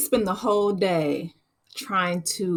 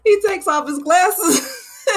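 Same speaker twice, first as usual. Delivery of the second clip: high laughing, near tears; 11 semitones higher, slower than usual. Real, not acted. Repeating the glasses scene to viewers, near tears laughing. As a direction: repeat it higher, laughing hard.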